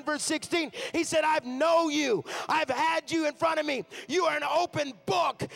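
loud, high, driving preacher's climax; preaching at full force. Acted. Preacher building the crowd up to a loud climax. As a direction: shout it out over the music like a revival call.

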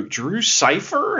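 drawn-out mock puzzlement; puzzled reader. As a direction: imitate someone sounding out an odd name. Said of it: "they go".

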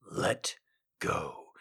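low, soft seductive whisper. Acted. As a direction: whisper it into her ear. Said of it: "he whispered into her ear".

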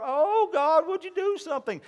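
high, whining plea; mock whiny prayer. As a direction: mimic a begging churchgoer. People begging God.

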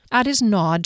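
ordinary delivery

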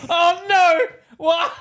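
loud, high, hammed-up wail; mock anguished cries. Friend clowning as the wounded superhero, mock anguished cries. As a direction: cry out in comic despair.

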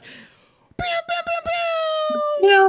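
zappy laser gun sounds; laser pew noises. Pretend. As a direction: fire off laser blasts.

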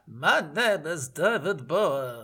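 mock European accent, slow and deliberate. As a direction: drag out a vague European accent. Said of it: "Indeterminate European accent".

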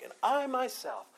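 high-pitched stress on "I" in "and I myself"; emphatic I. Shown as a demonstration. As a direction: lift "I" sharply, then come down through "myself". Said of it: "the I is emphatic".

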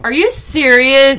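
mock shocked disbelief. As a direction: drag it out, outraged and let down. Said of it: "And he was like".

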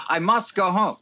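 put-on teasing South African accent. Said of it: "in your South African accent".

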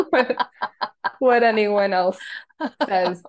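talking through bursts of laughter; laughing. Real, not acted. Guest joking to host about her daily dose, laughing. Laughing at own blunt advice.

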